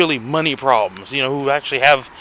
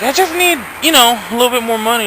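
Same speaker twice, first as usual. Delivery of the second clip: whiny self-mimic; 8 semitones higher, faster than usual. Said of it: "And it's like, I just".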